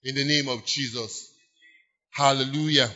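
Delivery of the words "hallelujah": usual speaking voice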